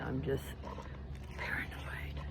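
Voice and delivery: whispering the word to herself; a hushed aside, almost unvoiced; whispered. Real, not acted. Whispering while out walking alone.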